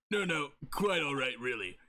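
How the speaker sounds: ordinary talk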